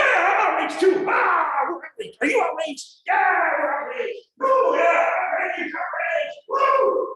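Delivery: cheering mock indignation, almost shouting; mock outrage. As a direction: whip each other into gleeful fake fury. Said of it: "they wanna get outraged".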